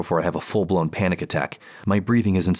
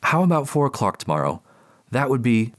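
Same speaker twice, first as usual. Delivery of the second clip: light, higher professional voice; polite clinical therapist; 6 semitones higher than usual. Acted. Therapist on the phone.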